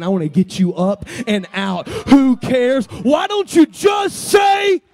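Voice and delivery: builds from loud to full yell; preaching shout. Retold or imitated. Preacher urging listeners out of the dark place, building shout.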